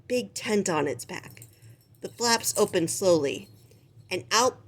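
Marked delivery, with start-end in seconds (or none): none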